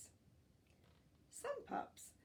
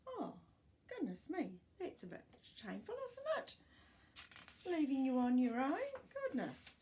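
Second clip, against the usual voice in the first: mock shocked dismay; 6 semitones higher, 5 dB louder, faster than usual. Real, not acted. Reader reacts to abandoned shark pups.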